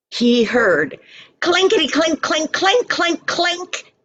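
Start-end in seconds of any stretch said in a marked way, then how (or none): 1.4-3.9 high, bright imitation of clinking bottles; clinking sound. A narrator's reading. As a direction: ring it out like glass jars knocking. Sound heard from outside the house.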